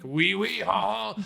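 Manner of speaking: lazy stock-French caricature; shitty French accent. Retold or imitated. Podcaster mocking lazy actors with a bad French accent. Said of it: "do a shitty French accent".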